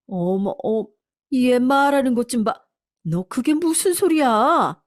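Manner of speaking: faltering start, then high outrage; stammer, then stretch out a high protest; shocked indignation. Acted. Mother-in-law answering daughter-in-law's accusation, high indignant disbelief.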